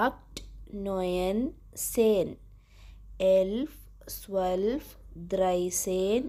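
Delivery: steady count from one to twenty, each number set apart by a pause; German counting demo. Shown as a demonstration. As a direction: read the numbers aloud for the class to repeat. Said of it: "ഒന്നും കൂടെ വായിക്കാം".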